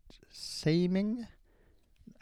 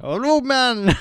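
Jamaican roadman; 10 semitones higher, 11 dB louder, slower than usual. Acted. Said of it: "Jamaican roadman".

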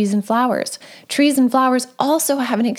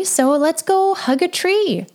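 higher, slow and coaxing, drawing the words out; gentle suggestion; 5 semitones higher, slower than usual. Retold or imitated. Mom gently inviting her daughter to hug a tree, slow and higher.

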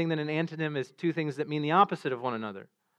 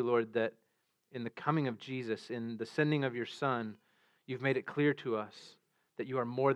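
soft, hushed pastoral prayer, mostly quieter; 4 semitones lower, 4 dB quieter than usual. Real, not acted. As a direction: pray humbly before the sermon.

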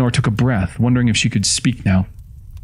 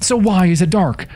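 high, frightened demand; desperate, pitched-up questioning; panicked question; 10 semitones higher than usual. A narrator's reading. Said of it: "then thought screamingly".

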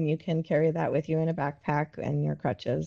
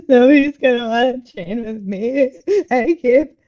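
half-laughing mock wail, higher and louder; loud, laughing version of a tearful lament; retold crying; 7 semitones higher, 8 dB louder than usual. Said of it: "sitting in my car just crying".

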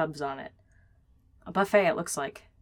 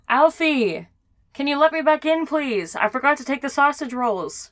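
raised voice calling through glass; shouting through window; 6 semitones higher, 5 dB louder than usual. Acted. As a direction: call loudly to someone inside. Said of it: "Chris shouts through the window".

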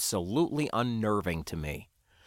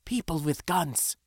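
put-on higher, tense accented warning; hissed warning; 6 semitones higher than usual. A narrator's reading. Sindra warning the spacer of armed people, hissing. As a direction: warn urgently without shouting. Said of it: "she hissed".

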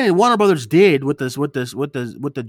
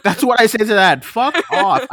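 higher, louder contemptuous brush-off; loud scoff; 7 semitones higher, 6 dB louder than usual. Real, not acted. Host ranting about long films, loud scoff. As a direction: shove the idea away, loud.

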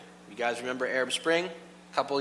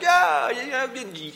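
high whooping cheer that drops away; mock euphoria; 9 semitones higher, much slower than usual. Stranger on the subway train shouting and yelling, euphoric. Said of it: "euphoric on the subway train".

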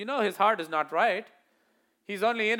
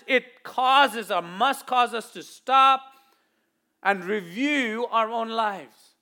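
slow, deliberate, louder; emphatic slowdown; 5 dB louder, much slower than usual. Acted. Preacher urging church to review lives, slow. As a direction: drive each word home.

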